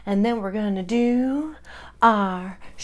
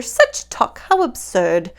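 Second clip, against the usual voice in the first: tut tut; 4 dB louder, slower than usual. Acted. Doctor dismisses Gertrude, mild tutting. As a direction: scold gently like a doctor.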